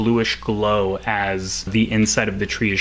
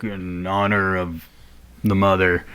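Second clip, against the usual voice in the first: Lazarus; 3 semitones lower than usual. Acted. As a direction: lower the voice for a restrained salute. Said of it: "looks around and he's like".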